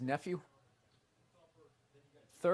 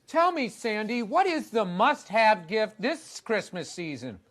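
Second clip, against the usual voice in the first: mock TV host; 6 semitones higher, 7 dB louder, slower than usual. Mock TV host asks Sandy.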